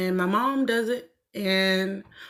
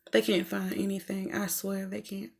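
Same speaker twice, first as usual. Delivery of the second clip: muttered aside; 10 dB quieter than usual. Real, not acted. Woman griping to herself about guys, muttered aside. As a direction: grumble it under your breath, fading away.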